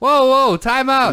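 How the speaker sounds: high, loud "whoa, whoa, time out"; loud call-out. Real, not acted. Catching a friend yawning mid-podcast, loud sudden call-out. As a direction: stop the room with a sudden loud shout.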